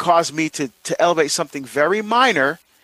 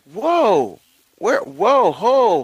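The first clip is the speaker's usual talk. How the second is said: higher, taken-aback whoa, whoa; startled whoa; 5 semitones higher, much slower than usual. Retold or imitated. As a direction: act caught off guard by the jab. Startled by wife's retort.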